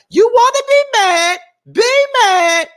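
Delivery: high, loud mock Keke line; imitating Keke. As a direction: squeal it like a sassy reality star. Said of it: "she was like".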